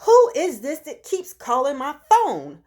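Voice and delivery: acting out exasperation at a nuisance caller; irritable. Woman fed up with repeated phone calls, irritable. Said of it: "I'm getting very irritable".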